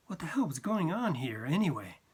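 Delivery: ordinary delivery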